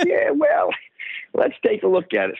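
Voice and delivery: high, chuckling doctor voice; amused doctor. Retold or imitated. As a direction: play the doctor laughing along, pitched high. Specialist amused, retold.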